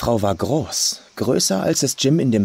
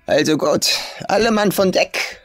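put-on rough old farm woman; 9 semitones higher than usual. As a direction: sneer it as the crude old farmer.

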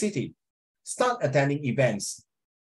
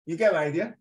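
ordinary delivery

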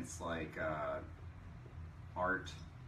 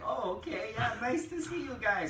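put-on Swedish accent; 12 semitones higher than usual. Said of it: "you're like".

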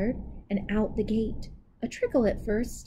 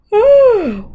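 loud high yawning sigh; big yawn; 11 semitones higher, 14 dB louder than usual. Acted. Anansi tired from watching, big loud yawn. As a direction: stretch out a huge yawn.

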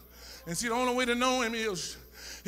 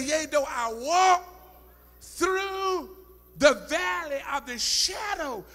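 slow, drawn-out, raised scripture; intoned psalm; 5 semitones higher, 6 dB louder, slower than usual. Acted. Preacher to congregation, slow intoned psalm. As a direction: lean on walk and through.

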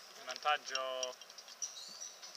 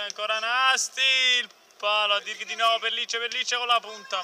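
excited commentary; 6 semitones higher, 6 dB louder than usual. Acted. Commentator calling a chance.

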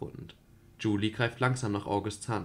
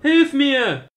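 very loud, much higher scream; full scream for help; 14 semitones higher, 12 dB louder than usual. Acted. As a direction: scream it with everything. (Julie schreit noch lauter um Hilfe, aus voller Kehle)

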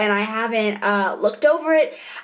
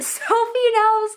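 laughing; 11 semitones higher, slower than usual. Real, not acted. Laughing about her bad chapter.

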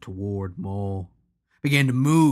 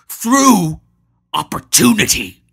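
retort; 10 semitones higher, 14 dB louder than usual. Acted. Hanno retorts loudly.

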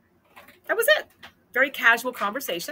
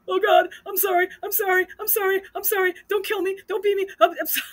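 high, whimpering imitation of a terrified kid; mock panicked pleading; 11 semitones higher, 5 dB louder than usual. Kid learns who husband is, hammed-up terrified begging. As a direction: beg rapid-fire and high, hammed-up, breaking into a laugh. Frightened kid begs inmates, mimicked.

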